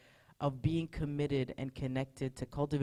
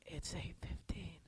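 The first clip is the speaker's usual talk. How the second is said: soft, slow groggy whisper; barely awake, murmuring to yourself; sleepy whisper; 3 semitones higher, 13 dB quieter, slower than usual. Retold or imitated. Woken by a call, pastor whispers sleepily to herself. Half-asleep, thinking in bed.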